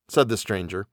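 plain reading voice

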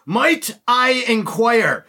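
very slow, high, drawn-out question; menacing formal officer. Retold or imitated. Officer cutting in on drunk soldiers, slow imitated formal menace. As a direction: stretch out every word as the imitated officer. Officer suddenly cuts into the argument.

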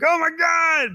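high, gushing mock-hype voice; mock fan excitement. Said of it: "everyone was like".

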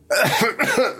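short throat-clearing cough; dry cough. Real, not acted. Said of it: "ich hab was im Hals".

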